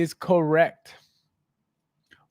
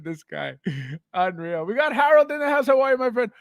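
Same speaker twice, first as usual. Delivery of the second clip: ordinary talk, nothing marked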